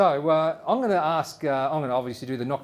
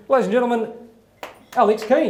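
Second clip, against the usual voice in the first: loud, high stage announcer build; 6 semitones higher, 6 dB louder, faster than usual. Real, not acted. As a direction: call the guest up to the stage. Introducing guest to the stage.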